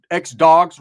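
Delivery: punched loud. Real, not acted. Explaining ex-dogs, punched loud. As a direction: bark the word ex-dogs out loud.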